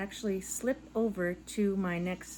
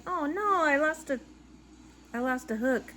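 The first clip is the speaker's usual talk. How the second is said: high, louder retold alarm; 7 semitones higher, 6 dB louder than usual. She re-enacts her alarm at losing a crochet hook, high and loud. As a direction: replay the moment she thought she lost a hook. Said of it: "I was like".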